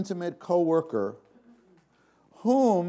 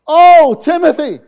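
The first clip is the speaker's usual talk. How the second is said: a loud, high cry full of longing; impassioned cry; 12 semitones higher, 16 dB louder than usual. Acted. Preacher voicing Paul's plea to his son Timothy, impassioned cry. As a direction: cry out his name from the heart.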